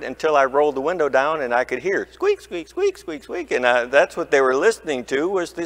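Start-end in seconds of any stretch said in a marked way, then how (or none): 2.4-3.0 high, rising squeak, squeak, like a rusty spring; squeaky car noise. Retold or imitated. Pastor mimicking his old car's squeak to the congregation, high squeaky voice. 3.0-3.5 squeak, squeak high and much softer, fading away; softer squeaky car noise. Retold or imitated. Pastor mimicking his old car's squeak fading, high soft squeak.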